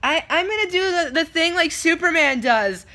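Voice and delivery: put-on high, loud eager child; little kid. Kid choosing a superhero pose, imitated child voice. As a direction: announce it proudly like a little boy. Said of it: "the one kid in my left's like".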